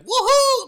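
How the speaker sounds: very high, loud mock "woohoo"; whooping cheer. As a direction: imitate a game hero whooping wildly. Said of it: "he's like".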